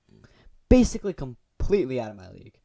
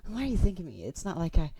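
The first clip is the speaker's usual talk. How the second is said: flustered, higher, huffy; tsundere girl voice; 6 semitones higher than usual. Acted. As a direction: huff it with a nervous giggle. Natsuki tsundere reply.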